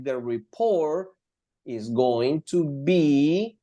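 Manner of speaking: slow words, drawn out with a pause; slow example reading. Shown as a demonstration. Teacher repeating the going to be example, slowly. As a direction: build the sentence slowly.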